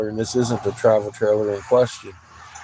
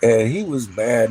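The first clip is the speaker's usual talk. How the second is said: ordinary delivery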